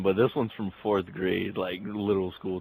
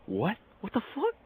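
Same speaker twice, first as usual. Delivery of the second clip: very high, incredulous squeak; baffled devil. Retold or imitated. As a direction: play a devil whose laugh turns to disbelief, pitch climbing.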